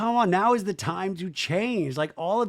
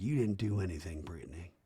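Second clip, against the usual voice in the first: much lower and softer, gentle consoling mother; quiet mom; 10 semitones lower, 10 dB quieter than usual. Retold or imitated. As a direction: say it low and hushed to comfort her daughter.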